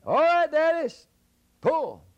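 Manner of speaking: very high, loud call from far off; high yelled call to the giant. Acted. Jack calls from the woods.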